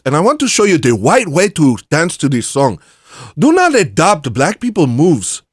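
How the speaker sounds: emphatic, high mock African accent. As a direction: announce it loudly in a put-on African accent. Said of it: "I would use an African accent".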